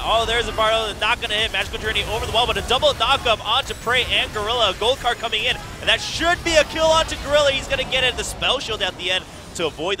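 loud excited esports casting; play-by-play. Real, not acted. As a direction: call the fight at full hype. Live play-by-play hype.

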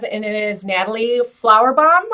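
ordinary talk

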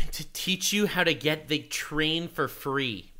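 slow, breathless mocking whisper; sneering whispered parody of the clip. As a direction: whisper it like a secret tutorial. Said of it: "mocking clip line in whisper".

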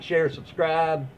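ordinary delivery

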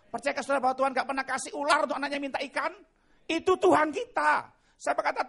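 fervent loud proclamation of sacrifice; full-force preaching. Acted. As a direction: pour it out like a revival preacher. Preaching climax and prayer.

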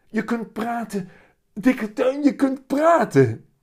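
put-on very high, loud happy little boy; joyful cry. A narrator's reading. Willem hugs his talking cat, overjoyed high shout. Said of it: "en roept blij".

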